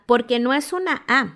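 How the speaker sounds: ordinary talk, nothing marked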